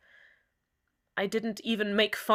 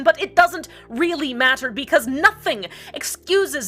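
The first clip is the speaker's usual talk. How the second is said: "doesn't" hit hard, higher and louder; heated; 6 semitones higher, 8 dB louder than usual. Acted. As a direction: bite down on "doesn't" in a heated rush. Heated opening of the rant.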